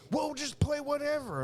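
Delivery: very high mock-friend voice; pestering friend. Friend brushing off [name]'s objection, high mock pestering. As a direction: push back in a squeaky imitation.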